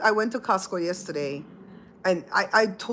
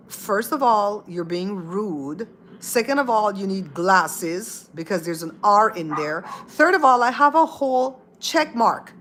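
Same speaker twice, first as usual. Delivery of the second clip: emphatic, indignant telling-off; indignant rebuke; 3 semitones higher, 5 dB louder, slower than usual. Retold or imitated. Streamer corrects a chatter who called her troll, indignant.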